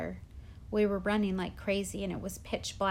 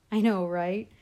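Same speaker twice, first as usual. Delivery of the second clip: laughing; 8 dB louder than usual. A narrator's reading. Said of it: "laughed Amos".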